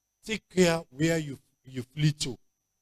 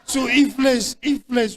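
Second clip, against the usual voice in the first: loud preaching; 9 semitones higher, 6 dB louder than usual. Real, not acted. Preacher building to a climax.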